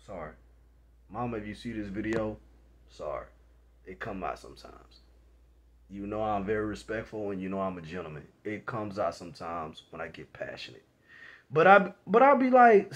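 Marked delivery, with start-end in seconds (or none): 0.0-10.9 soft, sheepish aside; sheepish apology. Real, not acted. Vlogger apologizing to his mama for cursing, softly. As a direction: apologize under your breath. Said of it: "apologizing to mom quietly".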